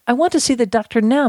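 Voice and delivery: patient standing his ground, hurried and high; firm demand. A narrator's reading. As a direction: insist firmly, without shouting. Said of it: "Mister Woodruff said firmly".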